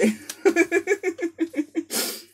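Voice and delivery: long, high peal of giggles; high giggling fit. Real, not acted. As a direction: burst out giggling at being teased. Laughing at the guides' teasing.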